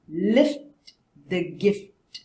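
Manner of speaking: slow teacher modelling; slow model sentence. Shown as a demonstration. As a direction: Lift the gift spoken slowly. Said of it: "repeat after".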